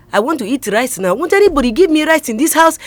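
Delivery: put-on Kansime's Ugandan accent. Actress practising on her house help, hammed-up Ugandan accent. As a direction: mimic a Ugandan skit character demanding rice from the house help. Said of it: "imitating what she was saying".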